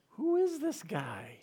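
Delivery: frightened disciples whispering to one another, awestruck; awed disbelief. Retold or imitated. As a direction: wonder aloud who he could be. Disciples amazed at Jesus.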